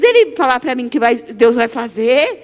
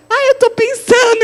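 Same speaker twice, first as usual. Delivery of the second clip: awkward helper; 10 semitones higher than usual. Retold or imitated. Lígia answering awkwardly.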